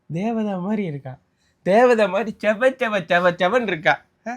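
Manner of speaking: husband teasing his wife by raving about the other woman; mock gushing praise. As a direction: lay the praise on thick as a joke. Husband gushing mockingly over photo.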